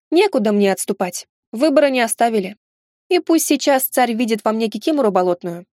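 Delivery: ordinary delivery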